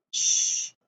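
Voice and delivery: long unvoiced "sh", as in shark; hushing sh sound; isolated letter sound. Shown as a demonstration. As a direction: hold sh alone with no vowel. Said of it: "our spelling sound cards".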